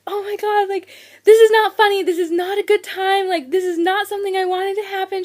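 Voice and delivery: high, loud whiny pleading; distressed protest. Retold or imitated. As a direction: imitate upset insistence. Acted protest to mother-in-law.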